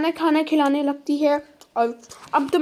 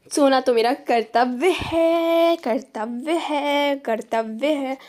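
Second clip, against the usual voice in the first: sleepy drawl; slower than usual. Acted. Sleepy boast about sleeping.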